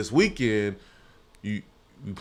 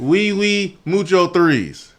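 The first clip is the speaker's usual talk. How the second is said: slow, high mock French; hammed-up Franglais plea; fake foreign language; 9 semitones higher, 4 dB louder, much slower than usual. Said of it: "I just mixed in Spanish, fake, uh, French".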